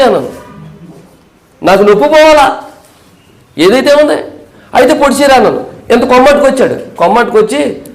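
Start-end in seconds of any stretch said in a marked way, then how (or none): 1.6-5.4 insistent retold urging. Pastor retells urging the man.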